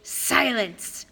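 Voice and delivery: a touch of snarled command. A narrator's reading. Monster silencing the girl, mild snarl. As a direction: bite the word off. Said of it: "Medusa snarled".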